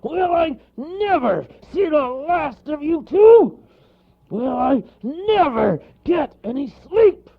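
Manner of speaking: slow, loud, high-strained bellow; fed-up bear shouting; exasperated bear cry. A narrator's reading. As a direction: roar each word in exasperation. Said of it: "cried the bear".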